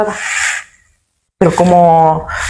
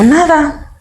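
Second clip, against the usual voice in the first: put-on small, high child's answer; girl tells her uncle nothing's wrong, high and small; her child self; 8 semitones higher than usual. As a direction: answer like a little girl brushing it off. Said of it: "Y digo".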